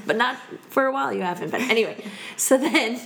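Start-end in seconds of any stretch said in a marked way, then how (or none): 0.0-1.6 mock tender laughing. Talking tenderly to her own knee.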